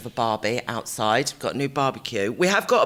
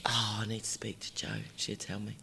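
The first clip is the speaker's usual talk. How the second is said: whispered aside, much softer; 4 semitones lower, 12 dB quieter than usual. Real, not acted. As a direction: hush it so nobody overhears. Aside, worried people may listen.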